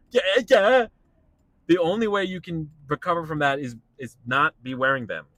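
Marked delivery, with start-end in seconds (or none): 0.1-0.9 high, loud mock yelp; acted panicked reply. Acting out being caught wearing pads, mock panic.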